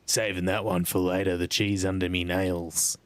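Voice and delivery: grubby peasant voice. Acted. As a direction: relish it like a filthy beggar. Voicing a grubby NPC.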